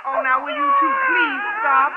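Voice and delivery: high exasperated cry for order; stop the quarrel. Acted. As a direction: shriek at them to stop, hammed-up. Teacher breaks up quarrel.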